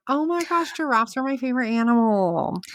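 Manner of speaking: high-pitched, adoring squeal at normal volume; delighted gushing. Real, not acted. Co-host hears the scar is giraffe-shaped, gushing. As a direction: gush in a high, smitten voice.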